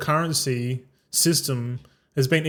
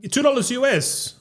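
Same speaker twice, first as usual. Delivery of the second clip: higher-pitched correction from the seller; retold insistence; 10 semitones higher than usual. Cambodian local naming the currency to a traveller, imitated insistence.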